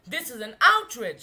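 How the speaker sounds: loud indignant shout; outraged shout. A narrator's reading. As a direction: shout in outrage at the departing car. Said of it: "she shouted".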